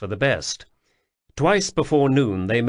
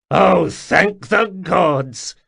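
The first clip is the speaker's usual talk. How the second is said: slow, louder mock-solemn intoning; sarcastic intoning; 7 semitones higher, 6 dB louder, much slower than usual. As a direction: drip with sarcasm. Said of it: "Sul intoned".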